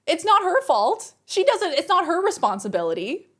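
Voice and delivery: high, indignant retold telling-off; fed-up scolding. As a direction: stick up for her, imitating exasperation. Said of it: "Laws had had enough".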